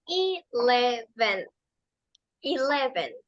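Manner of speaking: eleven with first E said as long I, ileven; English eleven. Shown as a demonstration. Teacher modelling for students, careful eleven. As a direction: stretch the first vowel of eleven like I. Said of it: "La E se pronuncia como una E larga".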